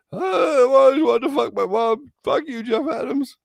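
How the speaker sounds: high, loud outraged squeal; high mocking voice. Mocking Jeff's reaction, high and loud. Acting out the victim.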